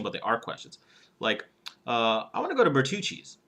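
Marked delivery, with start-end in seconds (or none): 2.3-3.4 higher pitch, phrased like a question. Shown as a demonstration. Coach showing a statement voiced as asking, questioning tone. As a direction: say "I wanna go to Bertucci's" with a questioning lilt. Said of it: "Okay, a little bit".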